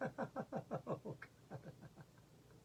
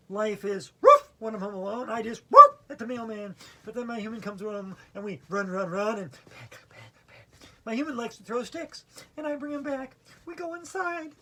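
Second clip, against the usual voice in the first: loud, higher-pitched doggy verse with barked woofs; dog poem recital; 5 semitones higher, 16 dB louder than usual. Acted. Yoga teacher reciting the poem Fetch as the dog, lively dog voice. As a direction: recite as an excited dog, howling out "howling". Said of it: "do the poem Fetch".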